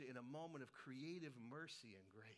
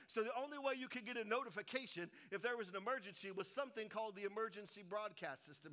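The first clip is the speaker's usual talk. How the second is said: usual speaking voice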